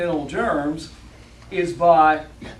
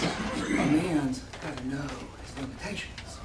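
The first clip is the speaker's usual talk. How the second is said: Clint Eastwood line; 3 semitones lower, 9 dB quieter than usual. Retold or imitated. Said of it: "the tagline is".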